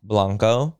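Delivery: Blanco with a Spanish a. Shown as a demonstration. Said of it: "[name], how you say blanco?".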